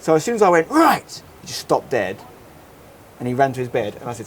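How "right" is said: sudden high-pitched "right"; retold shout. Dad halting his crying son, mock shouted command. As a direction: cut in sharply to stop a tantrum. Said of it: "the shouting through the door".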